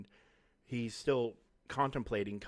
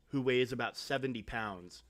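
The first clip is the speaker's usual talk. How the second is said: ordinary delivery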